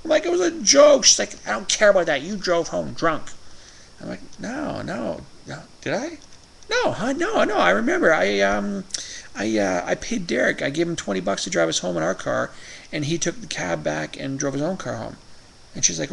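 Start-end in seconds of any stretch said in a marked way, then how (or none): 0.2-1.1 shrill high defensive yelp; high, loud protest. Retold or imitated. Quoting himself, defensive. 1.5-3.3 higher scolding wife voice. Retold or imitated. Quoting angry wife. 4.4-15.2 high-pitched flustered excuses. Retold or imitated. Quoting his flustered self.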